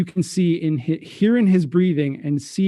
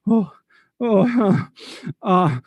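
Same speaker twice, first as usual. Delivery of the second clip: high sighs, catching breath after laughing; recovering from laughter; 5 semitones higher than usual. Acted. Francois holds a finger up, recovering from laughter. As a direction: wind down from a laughing fit. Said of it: "he has to stop".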